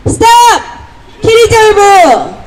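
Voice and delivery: high, slow rally cry, falling at the end; 구호 외치기. Real, not acted. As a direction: call out 키리졸브 as a drawn-out protest slogan. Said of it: "스탑 하면은 키리졸브 이렇게 외치시면". (높고 길게 외치는 구호)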